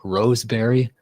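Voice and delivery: "roseberry" with a long o in the first syllable; repeated mispronunciation. Retold or imitated. Tipsy host quoting the slip, "roseberry" said with rose for rasp. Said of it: "I couldn't even say it properly".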